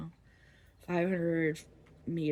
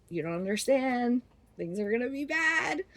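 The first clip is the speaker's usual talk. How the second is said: mock dire warning; 6 semitones higher than usual. Friends warning the seniors, mock-alarmed pleading. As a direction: pitch climbing on a retold warning.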